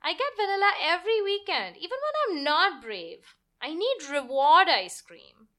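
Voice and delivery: put-on high child voice, leaning hard on need; a girl bargaining for a better treat; insistent little girl. Girl insisting to her father.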